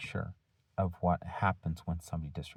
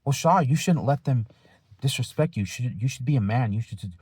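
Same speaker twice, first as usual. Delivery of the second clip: much higher and louder mock adviser urging him to be a man; mock advice giver; 6 semitones higher, 8 dB louder than usual. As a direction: ham up the pushy friend telling you to toughen up. Voicing others' advice to him.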